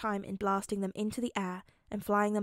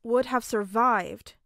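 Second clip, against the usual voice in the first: cutting off; 6 dB louder, slower than usual. A narrator's reading. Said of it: "Tony cut off".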